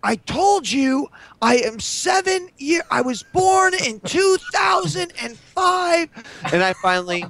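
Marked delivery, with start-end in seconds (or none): 0.0-6.1 put-on very high, slow indignant child voice; Mini Wheat. Young son setting gamers straight, drawn-out childish protest. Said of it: "He's like".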